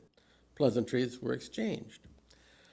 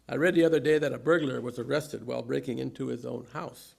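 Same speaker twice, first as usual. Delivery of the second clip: ordinary talk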